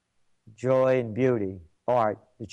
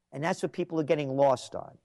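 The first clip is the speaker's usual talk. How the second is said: ordinary delivery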